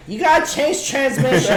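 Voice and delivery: loud, high mimicked kid demand; four-year-old girl. Four-year-old relaying orders to Ronan, loud child imitation. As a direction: boss him like a small child. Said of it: "feeding her lines to tell Ronan".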